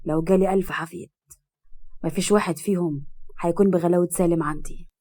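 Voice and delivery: low, lightly choked voice; mildly choked with love, pitched low. A narrator's reading. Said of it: "وقال له بصوت مخنوق".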